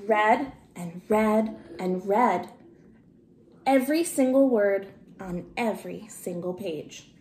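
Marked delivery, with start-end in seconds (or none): none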